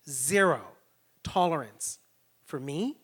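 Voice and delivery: ordinary delivery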